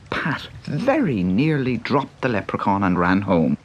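ordinary delivery, nothing marked

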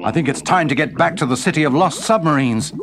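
quick, high submarine voice; hurried submarine. Acted.

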